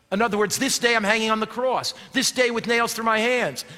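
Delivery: ordinary talk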